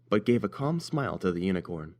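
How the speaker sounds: usual speaking voice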